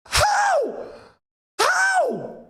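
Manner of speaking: very high cry of disbelief; disbelieving squeal. Real, not acted. Fan squealing in disbelief at a miss. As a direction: squeak out how in shock.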